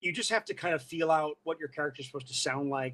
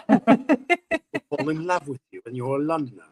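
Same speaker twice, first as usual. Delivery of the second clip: slow Londoner flourish; elegant London accent; 7 dB louder than usual. Shown as a demonstration. Showing a friend how to win them over, elegant London accent. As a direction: land it as a charming Londoner. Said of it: "very elegant sort of sound".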